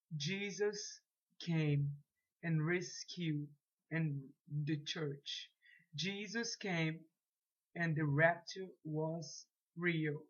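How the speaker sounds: put-on slow, careful English with a Brazilian Portuguese accent. Dreamer warning classmates about the rapture, slow Brazilian-accented English. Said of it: "Meu inglesinho, né, mais ou menos".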